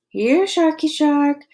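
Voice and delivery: slow, high teasing call; called through cupped hands, slow and teasing; mock calling. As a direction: taunt the shark like calling a pet. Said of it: "Monty called through cupped hands".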